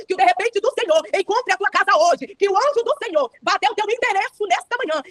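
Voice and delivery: preacher blessing the sisters this morning, fervent declaration; fervent preaching. Acted. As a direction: pray it over the congregation with rising fervor.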